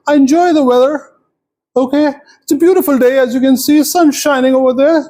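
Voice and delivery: put-on high-pitched chatty old lady; high, rambling elderly lady voice. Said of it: "'cause she's old".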